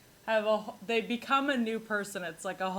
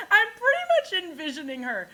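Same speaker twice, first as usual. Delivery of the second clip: laughing; 13 semitones higher, 4 dB louder, faster than usual. Real, not acted. Laughing about teacher.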